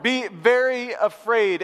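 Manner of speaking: slow, drawn-out ominous warning; deliberate, hammed-up dread; mock news warning. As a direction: stretch each word like a scare headline. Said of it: "a little more like this".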